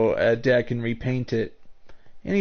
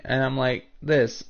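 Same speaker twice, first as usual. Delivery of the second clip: usual speaking voice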